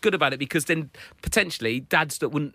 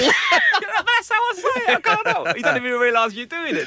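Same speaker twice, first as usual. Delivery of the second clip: squeaky mock voice; 4 dB louder, slower than usual. Mocking high voice.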